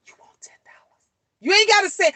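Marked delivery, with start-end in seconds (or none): 0.1-1.0 hushed, voiceless whisper. Shown as a demonstration. Mother shows how kids hear a quiet offer, whispering. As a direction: whisper it barely audibly. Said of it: "you can whisper".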